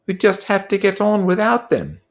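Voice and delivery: high, earnest schoolboy voice, slowing to insist; a fussy boy pleading for fairness; Piggy's voice. Acted. Piggy's line.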